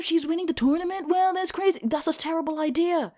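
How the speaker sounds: high, excited gossiping onlookers; mocking crowd voice. Girl imitating the crowd spotting the Miracle Kid, high and gushing. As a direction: squeal it like a starstruck crowd. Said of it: "They'd be like".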